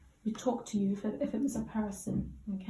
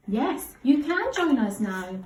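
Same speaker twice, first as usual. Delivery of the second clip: expressive read; 2 semitones higher, 6 dB louder, slower than usual. Shown as a demonstration. Teacher models the line for the child. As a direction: stretch it out with feeling. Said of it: "I'm gonna read this little bit".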